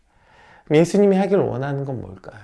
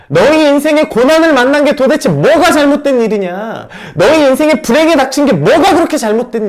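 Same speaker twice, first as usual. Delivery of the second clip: higher, louder forceful rebuke; strong rebuke; 12 semitones higher, 11 dB louder than usual. Acted. Jesus rebuking the disciples, forceful loud rebuke. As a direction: hit 뭐가 hard at full volume. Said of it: "이렇게 강력하게 책망하고 있는 것입니다".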